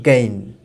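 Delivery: "গেইন" for again, the first a almost gone; weak opening vowel. Shown as a demonstration. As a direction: say "গেইন" low, with only a trace of a before it. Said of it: "আটা খুবই উইক হয়ে যাচ্ছে". (again-এর আ প্রায় না শুনিয়ে "গেইন")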